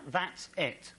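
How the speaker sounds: ordinary delivery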